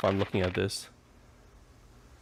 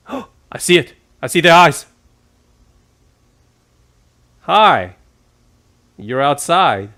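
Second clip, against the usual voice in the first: gasping, high and loud, startled; frightened at eyes on screen; scared horror game streamer; 5 semitones higher, 8 dB louder, slower than usual. Real, not acted. Jump scare while watching horror.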